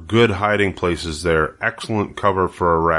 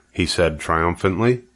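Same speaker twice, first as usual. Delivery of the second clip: usual speaking voice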